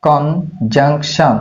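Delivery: drawn-out teaching pronunciation of conjunction; slow. Shown as a demonstration. Teacher showing students the word conjunction, slowly drawn out. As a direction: say conjunction very slowly, each syllable stretched. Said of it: "তারপরে interjection দেওয়া যায়".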